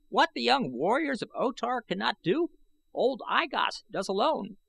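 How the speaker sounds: put-on high, reedy old man's voice, boastful. A narrator's reading. Old I Gos gloating to the young warriors, high and reedy. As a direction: crow about it like a proud old man.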